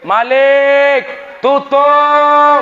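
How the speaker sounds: high, loud call; commanding shout. Acted. Prophet ordering Malik to close the gate, loud and high. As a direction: bark the order across to Malik.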